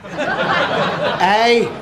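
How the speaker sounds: ordinary talk, nothing marked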